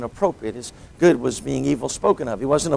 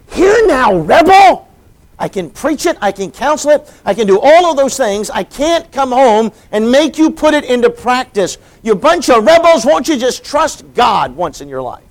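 much higher, much louder angry shouting; exasperated outburst; 10 semitones higher, 11 dB louder than usual. Acted. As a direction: yell at the rebellious couple in frustration. Pastor's imagined exasperated outburst.

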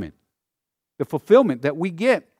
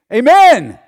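loud, high congregational shout; big ringing yell; crowd yelling amen; 10 semitones higher, 14 dB louder than usual. Acted. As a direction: cry out AMEN like a whole hillside answering. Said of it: "those that were on Ebal would yell".

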